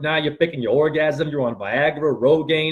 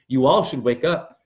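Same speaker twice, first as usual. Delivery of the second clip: normal talking voice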